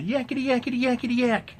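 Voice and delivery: high mocking yakety-yak voice; mocking chatter. Mocking women chattering at work, high and silly. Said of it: "going back and forth at each other".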